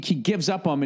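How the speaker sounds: ordinary delivery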